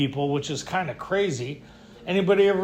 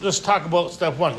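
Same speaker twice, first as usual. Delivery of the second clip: ordinary talk, nothing marked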